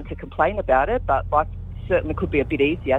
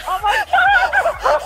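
overjoyed shriek; 4 dB louder, slower than usual. Real, not acted. Just won ten thousand dollars.

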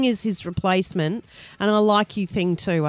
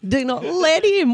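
high, slow disbelief; incredulous repeat; 7 semitones higher than usual. Retold or imitated. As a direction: echo it back, baffled.

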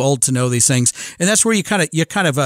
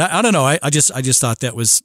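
usual speaking voice

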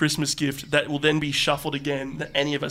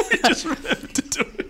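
laughing; 12 semitones higher than usual. Real, not acted. Admits late formalities.

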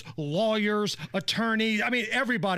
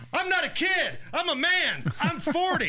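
Mike Gundy rant; 10 semitones higher than usual. Retold or imitated. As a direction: belt it out like a ranting coach. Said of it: "I remember when I turned forty, I gave the speech".

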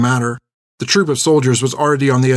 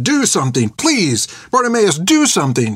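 desperate, high-pitched pleading; frantic plea at moderate volume; crying out; 7 semitones higher than usual. A narrator's reading. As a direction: beg him to act, voice leaping up. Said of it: "she cried".

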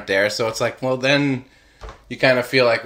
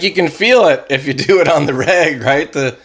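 normal talking voice

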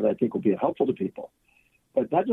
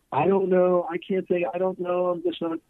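higher, lightly voiced flustered woman; imitating a stuck client, higher pitched; 6 semitones higher than usual.

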